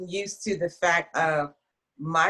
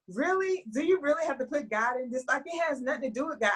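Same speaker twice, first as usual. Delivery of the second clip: higher, indignant pushback; exasperated retort; 7 semitones higher, 3 dB quieter than usual. Retold or imitated. Replying to her sister with high exasperation. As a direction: protest at being told off. Arguing back with sister, retold.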